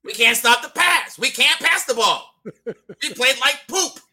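high, loud, incredulous coach rant; loud outraged disbelief, drawn out at the end; ranting impression. Co-host mimicking a playoff meltdown, ranting impression. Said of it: "not even sure that was an impression".

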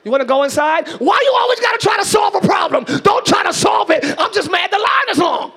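loud, high, hammed-up fuming about the line; mock angry rant. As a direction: play the angry person yelling at the Chick-fil-A line. Acting out angry person.

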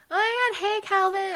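high, loud cheery call across the street; excited greeting. Retold or imitated. Riding downhill, she shouts a happy hello to Calvin. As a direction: call out to a school friend with delight. Spotting her friend while biking.

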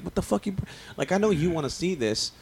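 high, exasperated mock outburst at the dad; imitating his own angry aside; pissed-off rant. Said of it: "I was pissed".

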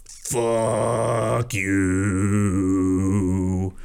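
low, drawn-out blaring "Fuck you"; rude horn. Pretend. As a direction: blast it like an angry horn. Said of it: "a horn that essentially just says".

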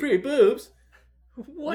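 high-pitched, loud disbelief; mock shock. Real, not acted. Friend mishears free booze as free boobs, shrieking disbelief. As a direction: squeal it like you misheard something amazing.

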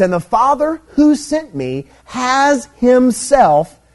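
high, slow, stretched HIMSELF; emphatic scripture quote. Acted. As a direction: read the verse weightily, landing slowly on Himself. Reading verse with heavy emphasis.

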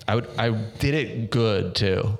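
ordinary talk, nothing marked

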